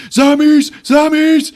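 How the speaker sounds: high, loud, panicked yells; frantic calling. Retold or imitated. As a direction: call out desperately for the lost boy.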